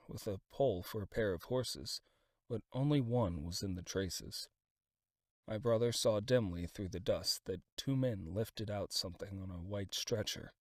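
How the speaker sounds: plain reading voice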